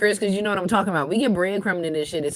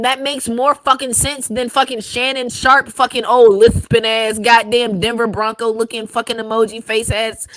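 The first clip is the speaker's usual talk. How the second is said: loud, higher heated ranting; shouting in disbelief at the choice; angry rant; 3 semitones higher, 6 dB louder than usual. Real, not acted. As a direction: go off, loud and fed up. Ranting angrily about Shannon Sharpe.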